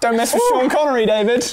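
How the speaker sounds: high, loud playful threat; mock warning. Player crowing to a friend after the card, mock warning. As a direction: warn them off loudly, hamming it up.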